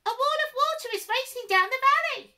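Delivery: very high, loud and fast squeak; squeaky urgent giraffe. A narrator's reading. Giraffe urgently warning the animals of the flood, squeaky and fast. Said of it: "in a surprisingly squeaky voice".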